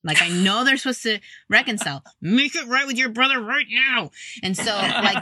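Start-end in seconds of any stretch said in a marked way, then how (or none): 2.2-4.1 higher, pushy parent command; a hint of a bossy mom; stern mother. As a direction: order the kid to make it right, mock-stern. Mother ordering kids to reconcile.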